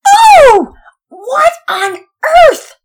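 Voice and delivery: very high, loud, drawn-out shriek; shocked shriek. Acted. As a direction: scream out in disgust and shock. Mother finds rotten pepper.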